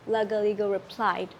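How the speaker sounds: usual speaking voice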